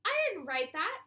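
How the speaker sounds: high, loud protest of innocence; exclaiming. A narrator's reading. BB protests to her teacher that she didn't write it. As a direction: blurt it out in alarm. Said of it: "exclaimed B B".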